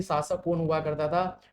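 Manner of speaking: ordinary delivery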